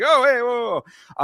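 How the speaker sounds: high, loud startled yelps; startled whoa. Retold or imitated. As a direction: jump back, startled. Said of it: "Keith Peterson was like".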